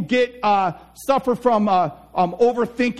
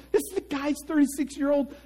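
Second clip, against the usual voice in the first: ordinary delivery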